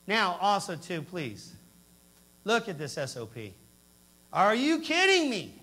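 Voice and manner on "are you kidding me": loud, slow outraged cry; angry disbelief. Retold or imitated. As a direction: hurl it out in disbelief. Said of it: "I was so angry".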